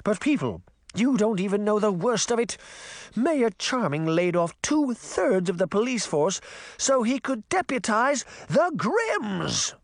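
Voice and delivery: high, imperious speech to a crowd; haughty Queen of Hearts, ranting. Acted. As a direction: whip the townsfolk against the mayor. Queen reveals a scandal.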